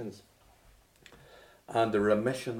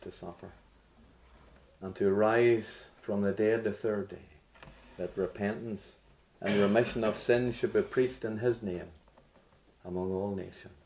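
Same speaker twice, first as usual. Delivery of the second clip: soft, lower prayer voice; 4 semitones lower, 5 dB quieter than usual. Acted. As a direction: pray quietly to the Father.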